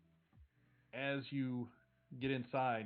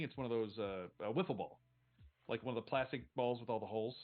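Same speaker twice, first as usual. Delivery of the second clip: ordinary delivery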